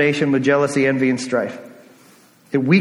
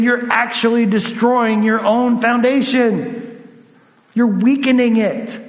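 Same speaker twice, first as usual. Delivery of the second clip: raised, emphatic appeal in higher pitch; fatherly admonishment; 6 semitones higher than usual. Acted. Paul to a divided church destroying its foundation, fatherly admonishment. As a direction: admonish them as a concerned father, drawing out key phrases. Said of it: "And Paul says".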